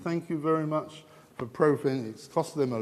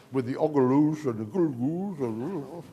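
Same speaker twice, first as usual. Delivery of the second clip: mock Turkish gibberish. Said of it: "That's how I speak Turkish".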